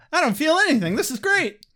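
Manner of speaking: high, giddy, laughing voice; pleased with himself while punching a wall; gleeful Riley. Retold or imitated.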